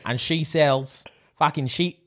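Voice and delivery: put-on broad Aussie accent; Australian accent. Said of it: "Australia and say".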